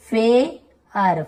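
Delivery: "फेयर" very slowly, as फे, then यर; broken into parts. Shown as a demonstration. Teacher showing how AIR sounds, "फेयर" slowly in two parts. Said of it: "यहाँ पर भी आप देखेंगे ऐसे".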